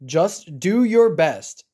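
ordinary delivery

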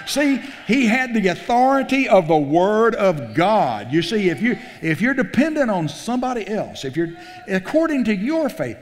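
high, loud preaching voice; preaching at full force. Real, not acted. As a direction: preach it with rising fire. Preacher rises to exhortation.

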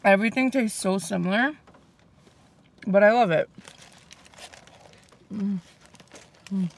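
eating between words; talking while chewing. Real, not acted. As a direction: mouth full of food. Seen 1: Chews with full cheeks while talking, licks lips, bites again at 8.7.

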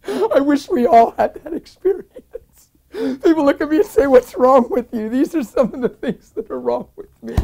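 high, choked, giddy testimony; laughing through tears. Real, not acted. Preacher telling his friend of the outpouring, tearfully overcome. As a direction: keep talking through real tearful laughter.

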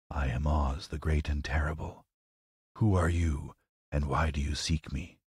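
low, hushed wizard from the fire; low, quiet voice. Acted. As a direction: speak low and softly as Oz, great and terrible. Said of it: "Then a low, quiet voice".

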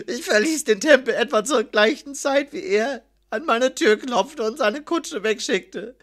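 high, whimpering, pleading account; sobbing. A narrator's reading. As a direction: whine like a beaten dog while confessing. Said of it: "schluchzte Promero". (schluchzend und winselnd, mit hoher Stimme)

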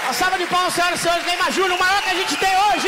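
loud, high emcee announcing a star; grand entrance announcement. Acted. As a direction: call out his name to the crowd. Comedian introduces guest to audience.

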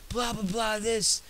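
high-pitched imitation of an angry teacher; mock yelling teacher. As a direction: mimic a teacher's exasperated rant up high. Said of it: "And she's yelling".

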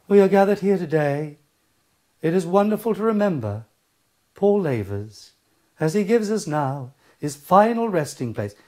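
mock sermon intoning, phrase by phrase; vicar voice. Vicar addressing mourners at a funeral, mock-solemn intoned delivery. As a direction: parody a priest chanting out a funeral address. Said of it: "vicars, priests".

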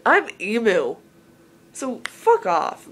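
slow, deadpan punchline; deadpan comeback. Shown as a demonstration. Teen showing viewers her joke reply to being called emo, deadpan. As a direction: drop the joke flatly and dismissively. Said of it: "My joke".

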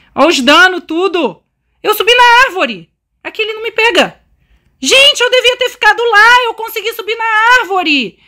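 high, loud panicked babble; panicking gamer. Real, not acted. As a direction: gasp it out in a panic. Escaping the monster up a tree.

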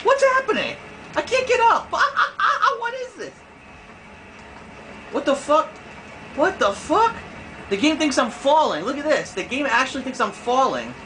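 real shocked squeal at the bugged game; incredulous. Real, not acted. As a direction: squeal in disbelief at the glitch. Disbelief at a glitching character.

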